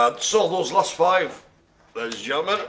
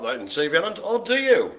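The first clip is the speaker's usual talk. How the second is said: ordinary talk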